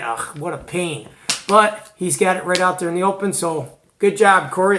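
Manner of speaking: normal talking voice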